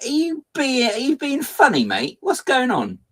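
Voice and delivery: very high, hammed-up outrage; mock indignant retort. Streamer retelling an imagined retort to the shop assistant, high mock outrage. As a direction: camp it up like a scandalised customer. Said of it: "it's like".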